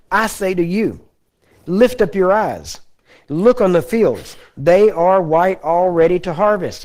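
slow, weighty Bible reading voice; measured scripture recital for the congregation. Acted. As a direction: read the verse slowly and solemnly, lingering on each phrase. Reads scripture slowly.

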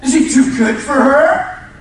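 loud, high, laughing shouted sermon; full-force preaching. Acted. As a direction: play Mary's clinging grab big and loud.

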